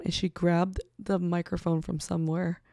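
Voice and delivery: normal talking voice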